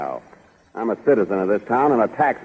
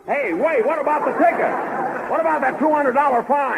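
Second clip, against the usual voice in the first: baffled; 12 semitones higher, 4 dB quieter than usual. Acted. Driver chases leaving cop.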